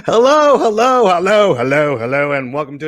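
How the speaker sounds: booming hello to the audience; loud greeting. Acted. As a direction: call out like a host. Show opening greeting.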